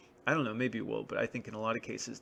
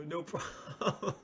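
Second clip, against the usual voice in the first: laughing through no problem slowly; 6 semitones higher, 3 dB quieter, much slower than usual. Retold or imitated. Fake employee brushing off a customer, laughing. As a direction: crack up mid-word at no problem. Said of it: "And you go".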